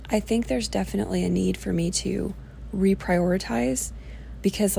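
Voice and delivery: usual speaking voice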